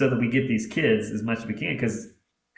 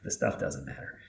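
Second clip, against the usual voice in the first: sincere hush; 5 semitones lower, 6 dB quieter than usual. Real, not acted. Heartfelt closing advice.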